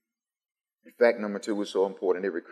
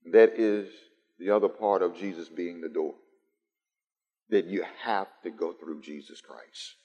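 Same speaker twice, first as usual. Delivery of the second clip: ordinary talk, nothing marked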